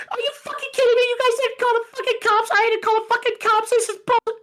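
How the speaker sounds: very high-pitched mock wail; imitated hysterical crying. Mom furious that staff didn't call the cops, mock bawling. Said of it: "bawling her eyes out, crying".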